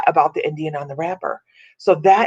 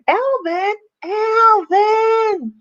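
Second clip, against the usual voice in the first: mock exasperated yell; 14 semitones higher, much slower than usual. Woman missing the Chipmunks, calling Alvin in a high mock yell. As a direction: holler it like the cartoon's call, building on the repeat.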